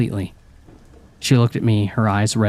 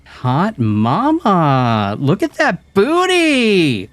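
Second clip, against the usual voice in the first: high, slow, hammed-up catcall; over-the-top hooting; 9 semitones higher, 3 dB louder, much slower than usual. A narrator's reading. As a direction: ham up a leering wolf whistle. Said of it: "wolf whistled and hooted over the top".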